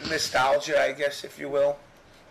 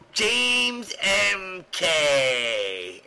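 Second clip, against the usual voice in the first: long drawn-out loud call, much higher; 8 semitones higher, 4 dB louder, much slower than usual. Retold or imitated. Fan calling Jimmy's name from across the room, drawn-out shout. Someone calling him from the corner.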